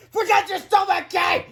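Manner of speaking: very high, loud scolding scream. A narrator's reading. Woman screams at the dithering superhero, high and loud. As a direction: yell it in exasperation. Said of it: "he screamed".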